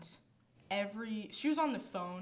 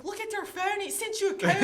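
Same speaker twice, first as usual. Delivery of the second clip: high, loud mock-Scottish; hammed-up Scottish hollering; Scottish accent scream; 12 semitones higher, 9 dB louder than usual. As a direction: yell it impatiently in Scottish. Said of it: "screamed at her in a Scottish accent".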